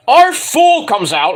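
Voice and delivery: ordinary talk, nothing marked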